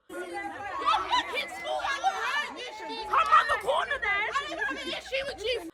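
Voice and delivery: mock her, laughing through it. Girl's threats retold, high laughing mockery.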